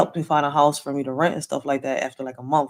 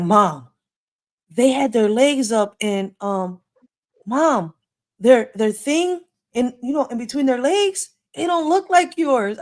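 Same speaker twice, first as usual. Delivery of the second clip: put-on child's voice; 6 semitones higher, slower than usual. Son describing the ladies.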